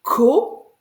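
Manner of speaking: "ko" alone, loud and high; isolated syllable. Shown as a demonstration. Teacher taking the first syllable on its own, loud and isolated. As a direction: punch out "ko" as one separate syllable. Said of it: "si je prends ma première syllabe".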